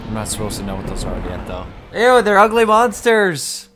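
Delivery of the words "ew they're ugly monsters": grossed out. Pretend. Mock disgust at aliens.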